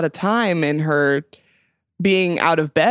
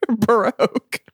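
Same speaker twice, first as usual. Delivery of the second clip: laughing; 4 semitones higher, slower than usual. Real, not acted. Laughing while repeating the word.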